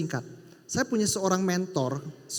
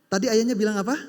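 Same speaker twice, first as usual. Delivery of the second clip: ordinary talk, nothing marked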